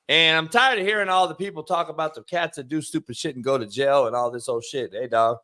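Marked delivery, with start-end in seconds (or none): none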